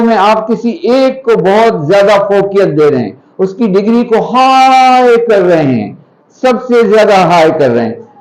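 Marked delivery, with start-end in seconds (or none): 4.2-6.1 हाई held very long; long, sustained stress on हाई; drawn-out emphasis. Shown as a demonstration. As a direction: stretch the vowel of हाई to show it rising above the rest. Stretches word to show raised degree.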